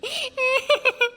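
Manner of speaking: high-pitched mocking laugh; mocking giggle. Girl laughing at two gossiping elders, mocking giggle. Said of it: "என்னடி இழிப்பு".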